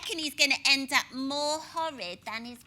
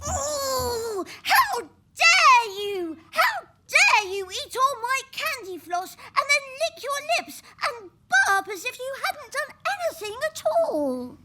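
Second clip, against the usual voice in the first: high, loud furious outburst; shaking with anger; 8 semitones higher, 4 dB louder than usual. Acted. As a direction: storm at him, stamping with rage. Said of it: "Peter gets really cross and he stamps his feet and he shouts".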